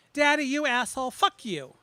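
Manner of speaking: loud raging outburst at the father; furious curse. Acted. Daughter cursing her dead father, furious and loud. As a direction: spit it out with full venom.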